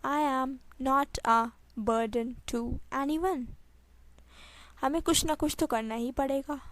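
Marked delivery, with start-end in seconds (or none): 0.1-3.4 measured, word by word. Shown as a demonstration. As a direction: leave gaps between the words. Teacher repeats sentence slowly. (धीरे, एक-एक शब्द)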